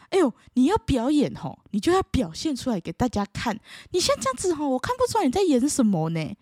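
a hint of a know-it-all elder scolding; pushy relative. Relative tells the student the acting isn't visible, mild preachy voice.

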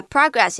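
PROGRESS as a verb, pruh-GRESS; stress on second syllable. Shown as a demonstration. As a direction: punch "gress" in progress, weaken the first syllable. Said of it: "就会放在第二个音节".

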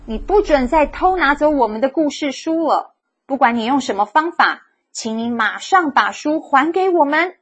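大声斥责，声音高而响; loud scolding. A narrator's reading. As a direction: call out sternly to the monster. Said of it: "对着窗外的怪物大声地说".

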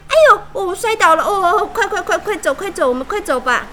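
high, loud yelp then fast urging; panicked fleeing. A narrator's reading. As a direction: scramble away in panic. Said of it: "她的朋友们看了就吓到了".

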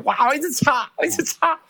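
high-pitched, flustered exclamation; retold frantic wiping. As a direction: voice leaps up in mock desperation. Re-enacts frantically wiping tears.

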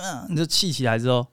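usual speaking voice

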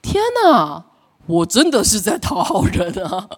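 stunned laughing confession; dismayed realization. Retold or imitated. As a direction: laugh at catching yourself out. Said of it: "我就越发现说".

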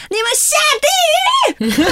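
very high mock-crying scream; wailed curse. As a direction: howl the curse like a sore loser. Curses everyone while crying at game.

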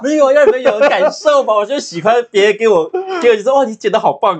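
high-pitched, loud, insistent pleading; playful loud protest. Real, not acted. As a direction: argue back loudly, begging for praise. Playful defence, wants praise from friends.

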